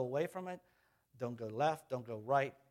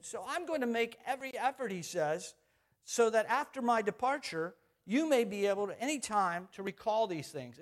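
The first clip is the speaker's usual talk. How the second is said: high, raised preaching read; preaching scripture; 7 semitones higher, 5 dB louder than usual. Acted. Pastor preaching Peter's farewell, raised and high. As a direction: lift the verse up high and strong.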